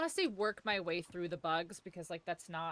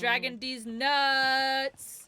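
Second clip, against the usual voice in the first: joking; 4 semitones higher, 7 dB louder, slower than usual. Pretend. Joking meme line.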